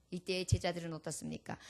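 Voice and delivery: usual speaking voice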